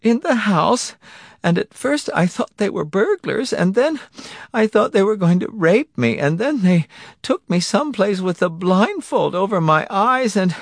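high-pitched account broken by gasps; sobbing helplessly. A narrator's reading. Wife telling her husband of the abduction, sobbing. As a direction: pour it out through panicked sobs. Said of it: "sobbing helplessly".

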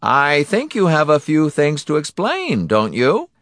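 high, loud, pointed villain; smug villain. Acted. Donati sending a husband to explain himself, smugly. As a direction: prod him with mock politeness.

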